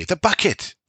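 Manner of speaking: put-on high child cry of discovery; excited child. A narrator's reading. Child spotting the bucket downriver, excited high. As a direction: shout the find like a kid.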